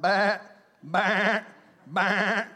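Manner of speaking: loud mock baa; playful loud sheep bleats; sheep bleating. Sheep wandering.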